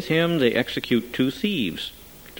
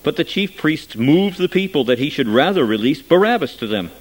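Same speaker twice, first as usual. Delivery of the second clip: ordinary delivery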